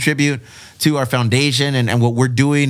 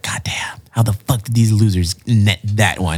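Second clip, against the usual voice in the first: hushed jealous whisper; 5 semitones lower, slower than usual. Retold or imitated. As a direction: whisper it like envious rivals. Imagined rivals muttering jealously.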